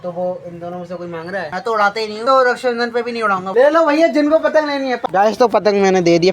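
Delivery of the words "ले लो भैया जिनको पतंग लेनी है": calling out. Real, not acted. Calling to kids to take kite.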